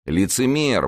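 high-pitched accusing exclamation; exclaiming. A narrator's reading. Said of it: "воскликнул Д'Артаньян".

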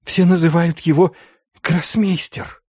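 high, fearful prisoner voice; frightened confession. A narrator's reading. Schulz gives inquisitors the sorcerer's title, high frightened confession.

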